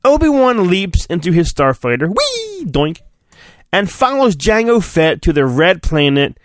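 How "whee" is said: a squeaky high whee for the takeoff. Pretend. Voicing Obi-Wan's starfighter leaping off with a high whee. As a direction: whistle the ship up and away.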